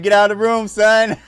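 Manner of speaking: a mock dad ordering kid out; retold dad shout. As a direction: bark it like a playful dad. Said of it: "I said".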